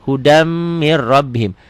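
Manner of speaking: "Hudam mir rabbihim" slowly, the m hummed, the r doubled; slow demo reading. Shown as a demonstration. Teacher models the whole phrase for beginners. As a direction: read it slowly and hold each merge. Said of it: "Ini mir rabbihim double aja".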